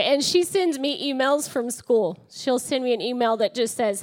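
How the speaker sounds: usual speaking voice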